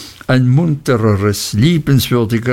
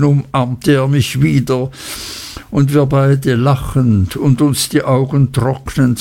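usual speaking voice

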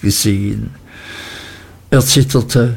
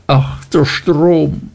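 higher, slow, anguished wail of a broken woman; passionate cry; 5 semitones higher, slower than usual. A narrator's reading. Martha wailing to the dark water, higher anguished cry. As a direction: let the cry rise high and stretch it slowly. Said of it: "rief sie leidenschaftlich".

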